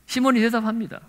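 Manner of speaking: ordinary talk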